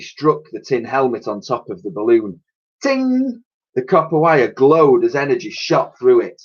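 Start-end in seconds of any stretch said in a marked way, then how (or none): 2.8-3.4 very high ringing ting. A narrator's reading. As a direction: ring out like metal. Narrator voices ting.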